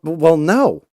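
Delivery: high loud flustered no; panicked stammer. Retold or imitated. Father answering son's calm question, high and flustered. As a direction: blurt it out high and rattled.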